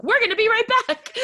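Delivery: high, loud holler with laughter in it; gleeful, hammed-up shout; mock announcer yell. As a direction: imitate someone yelling into a grabbed headset. Imitating wrestler yelling into grabbed headset.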